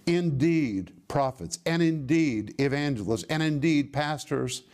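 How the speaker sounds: firm falling stress on every "indeed"; categorical, deliberate "indeed"; emphatic "indeed". Shown as a demonstration. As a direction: hit "indeed" each time as beyond question. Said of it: "means you should not question this".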